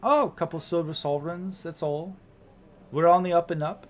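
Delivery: a hint of an obliging cab driver; lightly played helpful sky coach pilot; polite young woman driver.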